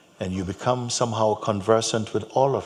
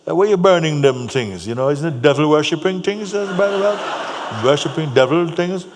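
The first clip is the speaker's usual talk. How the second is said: loud, raised-pitch mock accusation; crusty Jamaican man; 7 semitones higher, 7 dB louder than usual. Jamaican man questions the burning things, crusty and loud. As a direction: press the accusation, loud and high. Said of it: "very crusty voice".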